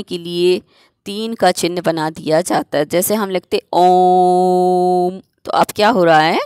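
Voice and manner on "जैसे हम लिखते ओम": "ओम" held very long, triple length; prolonged om. Shown as a demonstration. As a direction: stretch out "ओम" like a chant. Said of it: "तिगुना समय लग रहा है बोलने में". (ओम को तिगुना खींचकर)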